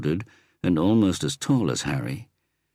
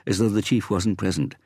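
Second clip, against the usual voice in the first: ordinary delivery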